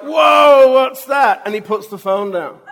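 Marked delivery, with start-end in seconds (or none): 0.0-1.4 loud high startled cry of whoa; startled shout. Retold or imitated. Man on phone shocked by returned stink, startled shout. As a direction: yell out like something just hit you.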